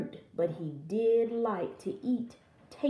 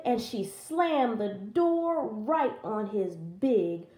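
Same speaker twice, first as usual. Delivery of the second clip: plain reading voice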